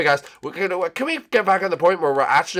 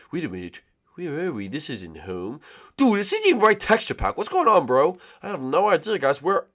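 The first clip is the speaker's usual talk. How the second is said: ordinary talk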